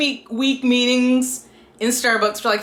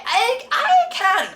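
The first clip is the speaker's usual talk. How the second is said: high, slow, stammering protest; mock flustered; 13 semitones higher, much slower than usual. Heroine balking at rooming with fake boyfriend, mock flustered. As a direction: stammer it high and drawn out, imitating her.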